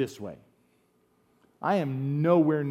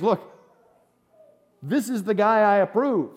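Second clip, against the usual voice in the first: a touch of a proud God, higher and louder; God's approving voice; 6 semitones higher, 6 dB louder than usual. Retold or imitated. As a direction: announce him the way God would, lightly. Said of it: "what he's saying is".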